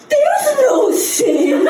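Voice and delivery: slow, drawn-out incredulity; imitating dad in disbelief; incredulous dad, mock.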